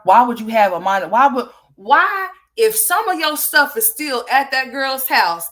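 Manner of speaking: furious questions hammered at her; demanding an answer, voice rising in outrage; angry yelling. Real, not acted. Angry rant at streamer.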